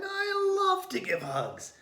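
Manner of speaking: very high, squeaky creature voice; a sweet porcupine who loves hugs. A narrator's reading. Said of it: "said the porcupine".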